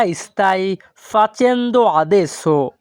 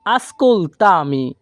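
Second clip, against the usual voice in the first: very slow, syllable by syllable; slow model word; much slower than usual. Shown as a demonstration. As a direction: spell it out with your voice. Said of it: "আর যদি আমরা একসাথে বলি". (ধীরে ধীরে উচ্চারণ)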